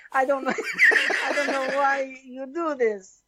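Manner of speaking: put-on laughing, plaintive Italian accent; giggly, hammed-up Italian protest. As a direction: plead in mock Italian through laughter. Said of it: "Jiggy, in Italian".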